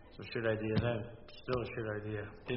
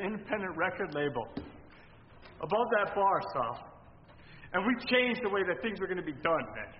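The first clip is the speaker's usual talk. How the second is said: raised, high-pitched angry rant; heated family shouting; 8 semitones higher than usual. Acted. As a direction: yell it in his face. Heated argument with label boss.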